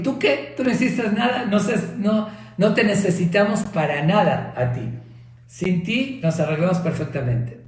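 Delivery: high, scornful taunting voice; mocking organs. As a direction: sneer down at the mouth.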